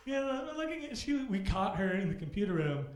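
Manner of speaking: bursting with disbelief at the reason; incredulous. Retold or imitated. Telling the crowd about the principal's complaint, incredulous and loud.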